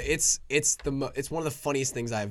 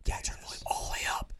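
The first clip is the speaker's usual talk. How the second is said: whispering; 5 dB quieter than usual. Real, not acted. Whispering in ASMR session.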